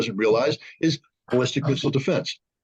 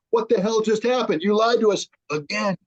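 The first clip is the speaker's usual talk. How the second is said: higher-pitched, exasperated retelling of a protest; mock outrage; 7 semitones higher than usual. Retelling Russians' reaction to the lie.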